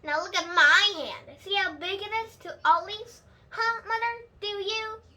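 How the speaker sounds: put-on a stubborn kid who won't be called a baby; Junie B's voice. Child demands mother look.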